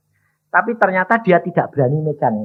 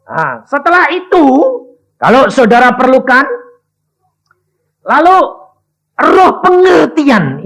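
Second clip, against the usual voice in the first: fiery preaching; 8 semitones higher, 6 dB louder than usual. Acted. Preacher crying out to the church about understanding. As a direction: preach it out to the congregation.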